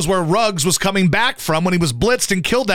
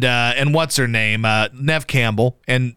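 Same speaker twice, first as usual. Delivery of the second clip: usual speaking voice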